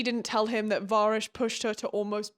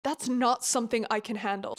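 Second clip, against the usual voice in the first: ordinary talk, nothing marked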